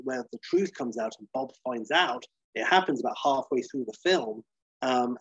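ordinary delivery

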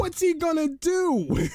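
very high, stretched-out squeal; hammed-up high whine, drawing out the words; mock wail. Co-host joking about Mills acting on emotion, high mock wail.

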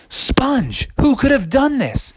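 high, dismayed frog voice; dismayed Froggy. Acted. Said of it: "Froggy was like".

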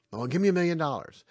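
hammed-up money-hungry voice; greedy wish. Voicing a greedy person.

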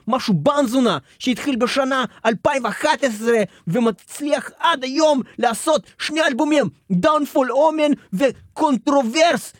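ordinary talk, nothing marked

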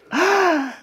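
sharp, high intake of breath; mock panicked gasp. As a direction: gasp as if suddenly realising the danger, hammed-up. Acts sudden panic.

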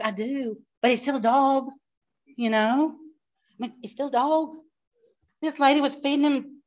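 ordinary talk

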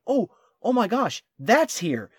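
astonished discovery. Retold or imitated. Traveler happening on a famous artwork, astonished. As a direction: relive suddenly spotting a treasure. Acting out stumbling on famous art.

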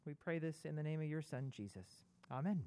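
ordinary talk, nothing marked

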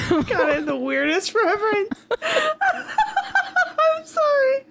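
high, drawn-out laughing apology; giggling through the words; laughing to tears. Real, not acted. Laughing at her own reference.

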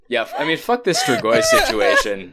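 high, loud laughing while talking; talking through big laughter; laughing hard. Real, not acted. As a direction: crack up and push the words through. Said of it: "I wanna know how Mooney dealt with my mother".

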